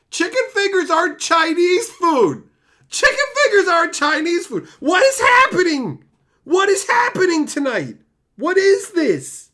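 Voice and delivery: screaming high and loud, half laughing; exasperated shout. Real, not acted. Streamer shrieking at his chat in laughing exasperation. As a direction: yell it like chat has broken your brain.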